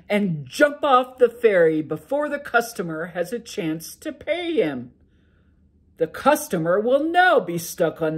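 a sleepy giant ogre grumbling to his wife; loud ogre brushing off his wife's worry. Acted. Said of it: "exclaimed the ogre".

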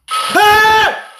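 sudden high-pitched scream of fright; startled scream. Real, not acted. Gamer jump-scared by Herobrine, loud high scream. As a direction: shriek as the monster jumps out.

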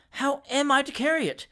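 high-pitched, mildly plaintive question; wretched. Acted. Scolded shooter asking how to hold his gun, wretched. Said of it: "said the wretched Winkle".

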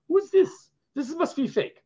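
high, baffled mock question with a laugh; mock disbelief at strange money; puzzled foreigner. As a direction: play a confused foreigner squinting at the bill. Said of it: "you imagine people in another country".

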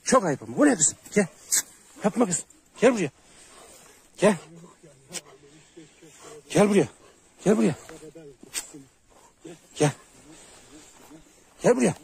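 high, loud real yell; shouting at dogs. Real, not acted. Shepherd yelling at his dogs Polat and Pamuk to stop, loud shout.

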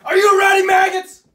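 screamed high and loud; barking drill sergeant. Acted. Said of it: "I'll be the sergeant".

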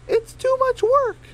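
very high, slow, lazy whine; very high, slow voice complaining it's too much work; high Flower voice. Acted. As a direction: stretch it out way up high.

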